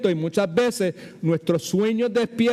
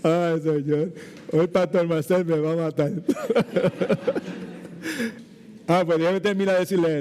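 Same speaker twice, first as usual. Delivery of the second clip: laughing. Real, not acted. Laughs at running over time.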